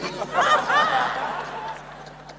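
high wheezing laughter; long high laugh. Real, not acted. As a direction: burst out laughing at the story. Laughs at own story.

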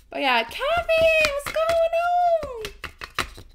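high, drawn-out hello to arriving viewers; excited greeting. Real, not acted. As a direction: sing out their names in greeting. Greets viewers as they join.

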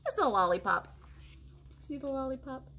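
usual speaking voice

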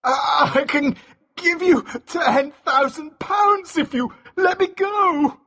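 very high, panicked stammer; shaking voice. A narrator's reading. Mascu offers money for his life, voice shaking. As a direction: bargain desperately in a high, shaking voice. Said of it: "his voice shaking".

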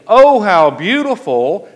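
hammed-up adoring sigh; mock gushing. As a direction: swoon over the sight in mockery. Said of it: "He doesn't say".

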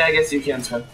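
normal talking voice

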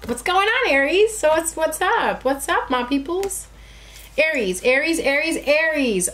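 high sing-song call; playful calling. Real, not acted.

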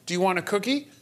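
lightly voiced adult offering a treat; offering to a child. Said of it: "if you say to the child".

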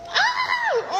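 sharp high yelp of shock; sudden high shriek. Real, not acted. Reacting to the manager reveal, a sudden high shriek. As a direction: shriek as it all clicks.

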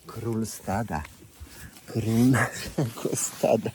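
slow, loud proclamation of the herd king; proud announcement. Real, not acted. Presenting the donkey king to viewers, slow and loud. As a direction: crown him out loud, slowly.

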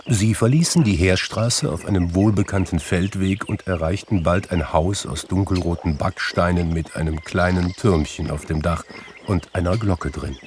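ordinary delivery

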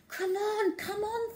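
put-on high, tense girl's urging; will the rat over the ramp. A narrator's reading. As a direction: Zoe silently urging Armitage on, high. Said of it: "thought Zoe".

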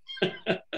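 quick high laugh; a short real chuckle. Real, not acted. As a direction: let out a brief laugh. Laughing after I love that.

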